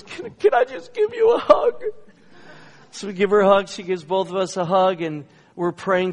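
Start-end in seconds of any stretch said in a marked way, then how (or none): 0.0-1.8 very high, loud, tearful plea; squeaky mock-crying voice, stammered start; imitated crying. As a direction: imitate a girl asking through tears. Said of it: "she's just crying".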